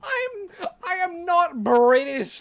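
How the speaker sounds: high, loud, drawn-out denial; slow, high wail of protest; indignant protest. Real, not acted. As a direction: wail it slowly in comic outrage. Protesting being called British.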